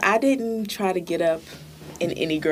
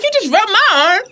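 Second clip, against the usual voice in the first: mock protest; 7 dB louder than usual. Acting himself, high protest.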